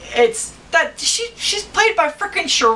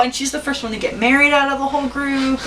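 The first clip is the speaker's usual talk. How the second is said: ordinary talk, nothing marked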